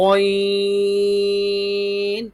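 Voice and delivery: غین starting on a rounded throat gho, held high; heavy ghain. Shown as a demonstration. Teacher models for pupils, heavy drawn-out غین. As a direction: puff the mouth and stretch غین thickly. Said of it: "منہ پھلا دینا ہے".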